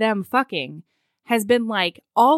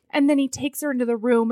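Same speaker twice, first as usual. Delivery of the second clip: normal talking voice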